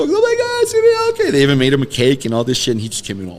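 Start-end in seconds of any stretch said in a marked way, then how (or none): 0.0-1.3 far higher, gushing fan voice; mock fans' squeal. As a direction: coo like excited fans soothing the star. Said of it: "They were so".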